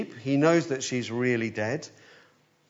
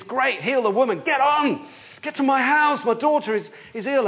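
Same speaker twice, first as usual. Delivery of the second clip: high, loud, hurried inner pleading; impatient urgency; 11 semitones higher, 5 dB louder than usual. Retold or imitated. Worried father urging Jesus on, impatient and pressing. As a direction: push him along, desperate to get home. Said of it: "impatient, he's urgent".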